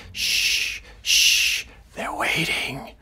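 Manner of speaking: hushing whisper, no voice. Acted. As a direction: shush her as if someone listens. Paranoid husband hushing wife.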